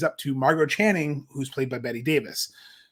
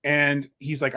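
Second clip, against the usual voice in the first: ordinary delivery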